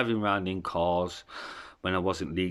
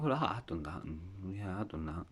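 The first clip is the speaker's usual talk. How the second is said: much softer, as if to oneself; quiet inner thought; 12 dB quieter than usual. Retold or imitated. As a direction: voice a private reflection under your breath. Imagined private thought while reflecting.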